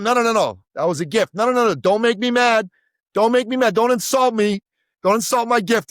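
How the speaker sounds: dad's mock-angry refusal to take back a loan. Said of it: "he'd get mad".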